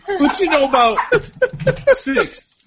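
squealed challenge, high and laughing; high hype voice. Acted. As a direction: yelp it up high through laughter. Hyping the Thick Six crew.

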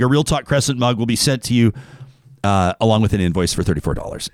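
ordinary delivery

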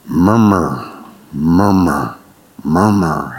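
deep crowd-grumble drone; mock murmuring. Shown as a demonstration. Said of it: "They start murmuring".

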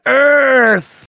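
high, loud drawn-out shout; hammed-up war cry; mock battle cry. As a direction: holler it like swinging through trees. Mimics the yell while swinging.

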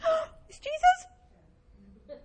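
big gasp, then high, loud squeal; drawn-out, high-pitched astonished cry; shocked realisation. Acted. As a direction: gasp and blurt it in hammed-up shock. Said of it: "you sit there and you think".

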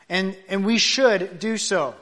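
usual speaking voice

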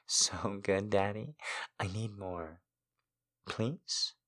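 breathy whispered begging; whispered pleading. Acted. Begging her lover in whisper.

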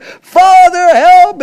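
high, loud desperate cry for help; a son being electrocuted shouts to his father, loud and high; crying out for daddy. Retold or imitated. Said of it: "and he's crying".

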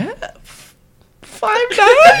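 hesitant, then comically high and loud; sheepish high squeak. Retold or imitated. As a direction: voice shoots way up on the answer. Acts out his nervous height answer.

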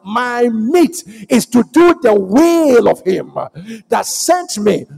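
loud, high declaiming, key words stretched out; full-force preaching. Real, not acted. Preacher declaring God's will to the congregation, full-force preaching. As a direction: drive each phrase home with rising force.

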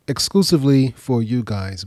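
ordinary talk

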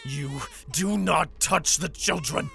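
slow, high, tearful fury; protecting his children through tears; furious, choked warning. Acted. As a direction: bite out each word in rage. Father defends his children.

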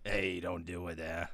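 hushed mock demon; soft mock darkness. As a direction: murmur it in the darkness voice. Imitating darkness too.

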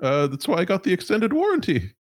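high, nervous corporate stammer; a flustered high-pitched executive. Acted. GM holds executive voice.

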